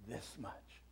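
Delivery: This much said very softly, falling; hushed answer. Acted. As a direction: breathe This much tenderly. Jesus answers on the cross.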